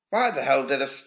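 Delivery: usual speaking voice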